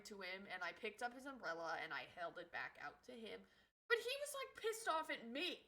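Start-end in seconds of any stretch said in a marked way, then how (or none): none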